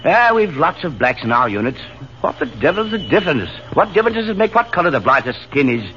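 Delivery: put-on hearty Australian accent.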